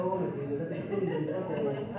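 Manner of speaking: low, soft, weary sigh of a mother who can't sleep; weary sleepless mother. Retold or imitated. Tired mother lying awake beside her snoring husband, low weary plea.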